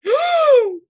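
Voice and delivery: loud gasp, pitch leaping; high shocked gasp. Real, not acted. Player hears the camper deny it, gasping. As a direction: gasp in real disbelief.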